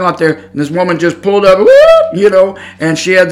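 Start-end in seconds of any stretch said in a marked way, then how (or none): 1.6-1.7 quick whooping car noise; imitated whoop. Storyteller showing how the woman's car pulled up, whoop. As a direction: make a swooping pull-up sound.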